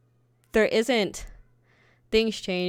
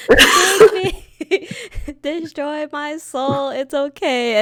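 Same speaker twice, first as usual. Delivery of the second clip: high, louder, slow, laughing swoon; over-the-top smitten fan pleading; mock swooning fangirl; 9 semitones higher, 8 dB louder, much slower than usual. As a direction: ham up a lovestruck teen. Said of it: "I'd be like".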